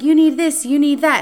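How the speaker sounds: mock pushy guy shoving makeup at her; pushy insistence. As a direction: insist hard, pile it on. Pushy date loading makeup.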